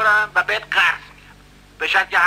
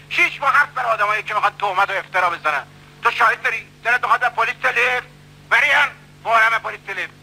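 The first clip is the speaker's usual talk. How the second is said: ordinary delivery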